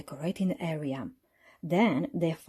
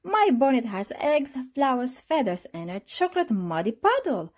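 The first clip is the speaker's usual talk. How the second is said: proud; 7 semitones higher than usual. A narrator's reading. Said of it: "Peppa said proudly".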